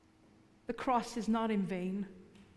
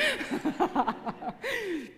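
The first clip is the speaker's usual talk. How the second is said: laughing; 6 semitones higher than usual. Real, not acted. Laughs after joke.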